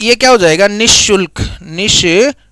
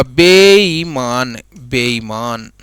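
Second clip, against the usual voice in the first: slow dictation; 9 semitones lower, much slower than usual. Shown as a demonstration. Teacher to students, dictating the correct word slowly. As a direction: sound out बेईमान slowly, letting it fade. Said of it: "बेईमान नहीं होता".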